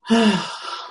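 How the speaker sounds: long breathy sigh out; heavy sigh. Real, not acted. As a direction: let out a big exhale. Reacting to own harsh judgment.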